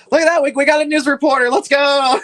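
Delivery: loud, high, fast cheer; thrilled, rushing shout; excited celebration. Real, not acted. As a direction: whoop it out in triumph. Celebrates getting a voice actor.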